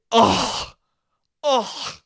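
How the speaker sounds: exaggerated appalled "ugh", repeated; disgusted scoff. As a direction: scoff in mock horror. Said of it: "they went like this to me".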